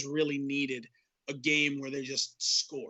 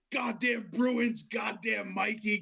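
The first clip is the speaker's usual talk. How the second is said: angry fan rant; 4 semitones higher, slower than usual. Retold or imitated. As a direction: curse the teams like a bitter Boston fan. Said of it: "And he's just like".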